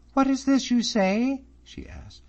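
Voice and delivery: high, loud, alarmed questions; suddenly concerned; startled asking. A narrator's reading. Miss Drummond stopping short, high startled questions to David.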